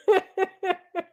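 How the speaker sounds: high giggle trailing on; laughing. Real, not acted. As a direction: giggle at your own joke. Laughs at own gross joke.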